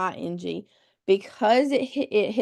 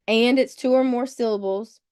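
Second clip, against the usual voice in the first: usual speaking voice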